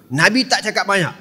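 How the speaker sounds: ordinary talk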